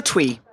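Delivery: "twee" for Twix, no final consonants; silent X. Acted. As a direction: say Twix as "twee", rhyming with tree. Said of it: "assumed the X was silent".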